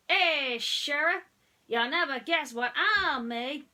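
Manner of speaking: high, loud country holler. A narrator's reading. Tow truck hailing Sheriff excitedly, loud country voice. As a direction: holler across the road eagerly.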